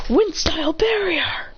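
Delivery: high, drawn-out battle call; attack call. Acted. Fighter naming his move in battle, drawn-out attack call. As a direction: call out the jutsu's name.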